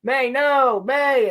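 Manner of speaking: high, loud pleading calls of her name; hammed-up cry as she walks away; mock desperate yelling. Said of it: "And he's like".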